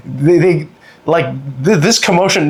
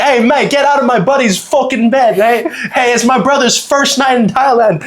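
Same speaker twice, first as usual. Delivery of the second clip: high, badly faked British accent; 10 semitones higher than usual. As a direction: play the British buddy butting in, hammed-up. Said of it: "I can't do the British accent".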